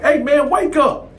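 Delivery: high, urgent wake-up call. Retold or imitated. Narrator waking his bunkie, high and urgent. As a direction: rouse the sleeping cellmate.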